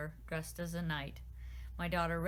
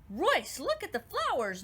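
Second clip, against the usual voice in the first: higher, louder alarm; urgent cry; 8 semitones higher, 5 dB louder than usual. A narrator's reading. Pixie Nawadi to the sprite prince, high urgent cry. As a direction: call him over to look, urgently. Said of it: "Nawadi cried".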